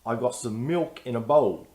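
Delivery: milk and bowl said with a British dark L. Shown as a demonstration. Teacher showing the British L. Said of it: "a British dark L sounds different".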